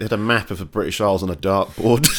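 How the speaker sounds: usual speaking voice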